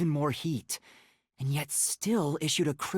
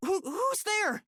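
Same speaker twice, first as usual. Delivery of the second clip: startled; slower than usual. Acted. Startled by someone approaching.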